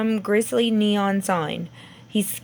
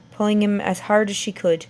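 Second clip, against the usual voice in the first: plain reading voice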